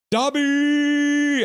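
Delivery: high, loud, drawn-out cry; excited shout. Acted. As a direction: shout the name out, stretching the end. Reading out an excited chat message.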